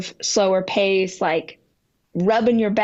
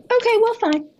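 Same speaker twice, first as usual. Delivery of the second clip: high, huffy "fine" while conceding the point; grudging acceptance; 12 semitones higher, faster than usual. Retold or imitated. To boyfriend after his lesson, a high grudging huff. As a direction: give in, but make it sound like a sulk.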